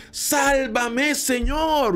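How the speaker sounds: high-pitched mock shout, no real volume; retold cry for help. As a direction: call it out high, as Peter pleading to be saved. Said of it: "comienza a gritar también".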